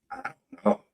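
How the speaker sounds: breathy whisper to himself. Real, not acted. Trailing off to himself.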